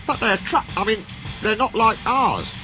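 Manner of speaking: high, faltering engine voice; finishing lamely. A narrator's reading. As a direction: break off and correct yourself lamely. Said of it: "he finished lamely".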